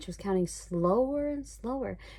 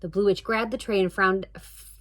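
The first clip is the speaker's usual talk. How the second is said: ordinary delivery, nothing marked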